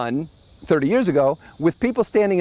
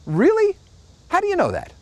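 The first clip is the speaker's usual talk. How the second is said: high, sarcastic "really?"; incredulous scoff; 14 semitones higher than usual. Real, not acted. Scoffing at a pundit who claims to know what consumers want, high incredulous. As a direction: leap up in mock disbelief. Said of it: "mocking the false oracle".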